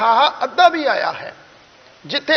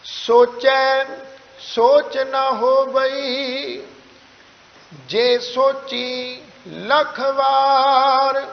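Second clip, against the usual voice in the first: slow, measured Gurbani recitation; scripture recitation; much slower than usual. Shown as a demonstration. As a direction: draw out each phrase on a level tone, pausing between. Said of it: "ਉਚਾਰਨ ਕਰੀਏ ਜੀ". (ਗੁਰਬਾਣੀ ਦਾ ਸਹਿਜ ਪਾਠ)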